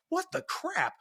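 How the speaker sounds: high-pitched, retold disbelief; mock exasperation. Dad reacting to his growling son, mock-exasperated and high. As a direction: replay a dad's baffled protest.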